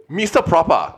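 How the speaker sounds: high, slow mock German, laughing; hammy German impression; fake German accent. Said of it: "what accent are you doing right now".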